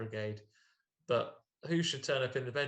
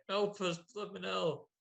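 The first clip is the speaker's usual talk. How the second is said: ghoulish plea; 8 semitones higher than usual. Retold or imitated. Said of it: "and say".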